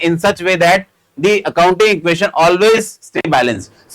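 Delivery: reads each word out slowly for students to copy; dictating the line word by word; slow dictation. Shown as a demonstration. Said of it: "reads the definition out for dictation". (धीरे धीरे लिखवाते हुए)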